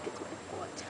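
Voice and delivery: whispered aside to the camera. Real, not acted. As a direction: whisper it, soft and unvoiced. Filming alone on camp.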